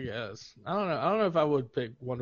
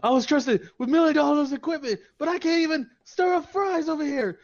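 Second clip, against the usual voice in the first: higher, louder hammed-up movie line; Rambo quote; 11 semitones higher, 5 dB louder than usual. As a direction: quote the film line dramatically. Quoting a Rambo film line.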